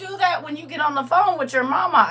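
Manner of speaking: high, loud imitation of a mother scolding; exasperated mother. Daughter scolding her whining child, exasperated imitation. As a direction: mimic a fed-up mother, pitched high.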